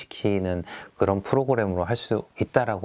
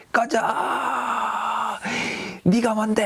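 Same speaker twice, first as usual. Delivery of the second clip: venting yell; 13 semitones higher than usual. Shown as a demonstration. Teacher models venting frustration while breaking paper, high yell. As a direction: stretch each cry out and send it high.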